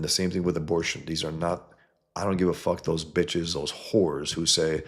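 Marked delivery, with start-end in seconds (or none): none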